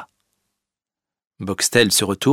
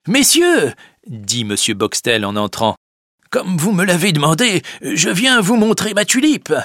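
higher, smug Boxtel; Boxtel's voice; 9 semitones higher, 3 dB louder than usual. Acted. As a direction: show off your tulip. Boxtel enters.

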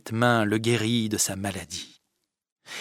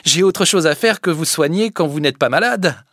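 higher, louder brusque brush-off; curt Fadette; 7 semitones higher, 9 dB louder than usual. Acted. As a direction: cut him short. Fadette repousse Sylvinet. (réplique sèche de la fadette)

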